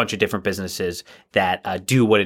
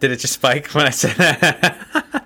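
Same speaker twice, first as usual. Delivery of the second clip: higher, laughing question; 6 semitones higher, slower than usual. Real, not acted. Asking co-host if the mic spiked, laughing. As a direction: ask it through real laughter. Cracking up at sound check spike.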